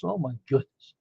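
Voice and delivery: ordinary delivery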